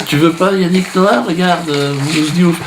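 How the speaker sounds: high, wheedling fairy voice; coaxing mock fairy. Wish fairy offering the boy other stars, mock coaxing. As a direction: coax a child with a substitute, hammed-up. Fairy coaxes child toward another celebrity.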